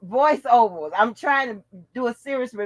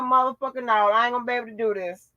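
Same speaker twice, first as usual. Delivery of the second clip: ordinary delivery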